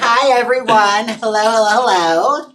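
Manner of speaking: cheerful loud welcome; bright loud greeting. Real, not acted. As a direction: call out hello to everyone. Stream greeting to viewers.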